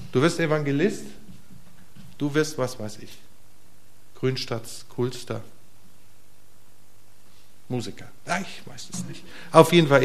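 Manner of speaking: ordinary delivery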